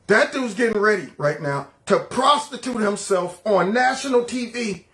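ordinary talk, nothing marked

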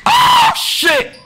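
sudden soaring yell of shock; excited shout. Real, not acted. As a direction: scream it out as the shot drops. Clutch shot in game.

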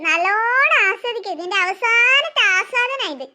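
drawn-out, slow taunt with a laugh; slow gloating mockery. Jealous girl taunting her sister at the mirror, slow gloating laugh. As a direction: savour every word, slowly, gloating.

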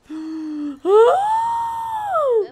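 long high-pitched startled gasp; loud, drawn-out squealing gasp; shocked gasp. Real, not acted. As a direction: gasp shrilly at a sudden sighting. Startled by ghost.

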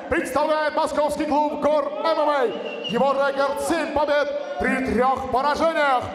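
ordinary talk, nothing marked

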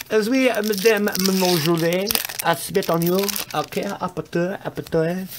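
high-pitched, hammed-up French gibberish; mock French accent. Joking about how the French talk, mock French accent. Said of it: "that's how they talk, I heard".

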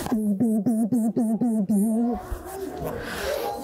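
quick low buzzing; bee buzzes. Shown as a demonstration. Leading kids in a trapped-bee buzz. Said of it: "make a lot of little buzzes of a bee".

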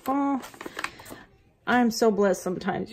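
ordinary talk, nothing marked

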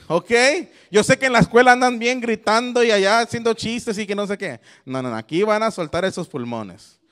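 ordinary talk, nothing marked

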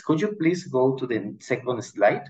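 ordinary talk, nothing marked

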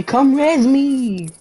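slow, high imitating a needy teammate; mock a whiny plea, drawn out; whiny imitation. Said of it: "when I'm that low and you say".

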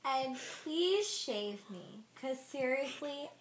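high little character voice; lightly voiced tiny creature; squeaky character voice. Voicing a body part prank.

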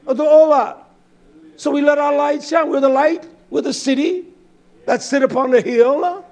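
loud, high, driving preacher's cry; fiery preaching. Acted. Preacher rallying his flock with a loud, high cry. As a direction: preach it out full force to the congregation.

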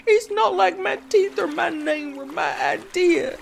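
high, tearful sobbing protest. Acted. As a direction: cry it out, voice breaking with hurt. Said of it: "Princess Bucktooth sobbed".

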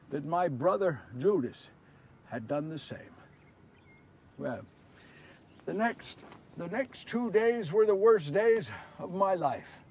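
hushed, grieving recollection; quiet sorrow. Acted. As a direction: recount it softly and heavily. Sorrowful memory of worst days.

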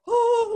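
high, loud "oh"; tarantulas' mock cry. Tarantulas when everything's tense between them, high mock cry. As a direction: let out a sudden high, loud oh. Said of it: "So they're like".

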